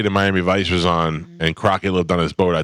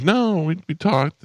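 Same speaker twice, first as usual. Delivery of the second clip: put-on girlfriend voice; 11 semitones higher, slower than usual. Voicing the girl in retold chat.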